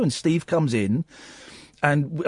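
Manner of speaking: ordinary delivery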